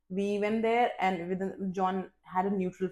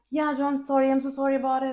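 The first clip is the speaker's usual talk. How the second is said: high, louder apology on the verge of tears; near-tearful apology; 6 semitones higher, 6 dB louder, faster than usual. Retold or imitated. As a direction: re-enact apologizing while holding back crying. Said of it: "I was almost in tears".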